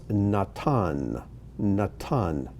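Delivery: Natan with ah in both syllables, stress on TAN; Hebrew pronunciation. Shown as a demonstration. Teacher models the first name for learners, Hebrew pronunciation. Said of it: "these are the Hebrew pronunciations".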